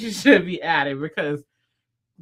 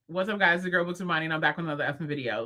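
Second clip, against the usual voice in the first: lower, softer, still fast intro; 3 semitones lower, 4 dB quieter, much faster than usual. Shown as a demonstration. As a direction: drop the tone but keep rattling it off. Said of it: "said it in a lower tone".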